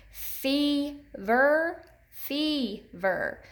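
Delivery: "fever" split into two slow beats, long E in the first; syllable by syllable. Shown as a demonstration. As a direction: say "fever" as fee, pause, ver. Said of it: "Do you hear that long E".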